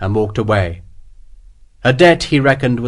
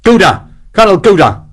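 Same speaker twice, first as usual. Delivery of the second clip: loud, higher barked snarl; snarled name; 8 semitones higher, 7 dB louder than usual. Acted. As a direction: snap the name to stop the guard. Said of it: "Sharp snarled the magic words".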